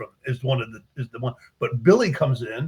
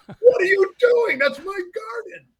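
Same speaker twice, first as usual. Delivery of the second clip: indignant priest; 6 dB louder than usual. Retold or imitated. Priest finds a man digging in his garden, outraged high voice. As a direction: play the priest huffing at a stranger.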